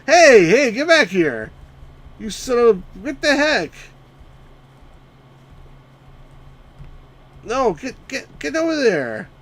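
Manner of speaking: furious shepherd hollering; exasperated shouting. Real, not acted. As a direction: bellow at them to get back. Yelling at runaway sheep.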